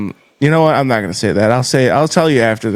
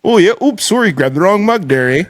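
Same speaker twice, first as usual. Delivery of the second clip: high apologetic Canadian voice; over-polite Canadian; mock Canadian host; 5 semitones higher than usual. Inviting guest to game, mock Canadian voice.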